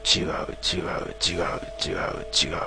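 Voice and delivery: slow, unvoiced, muffled chant of one word; slow muffled whisper. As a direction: drone it slowly into the back of a head. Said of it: "何度も何度も繰り返された".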